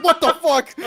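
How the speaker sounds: high, loud mock disbelief; shocked outburst. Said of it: "I'm like".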